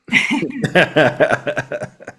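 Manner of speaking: long, high-pitched laugh. Real, not acted. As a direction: burst out laughing at your own joke. Laughs after own joke.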